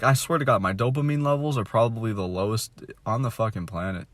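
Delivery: ordinary talk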